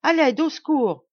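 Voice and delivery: loud, high desperate cry; crying for help. A narrator's reading. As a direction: scream for help with all your breath. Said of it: "s'écria-t-elle".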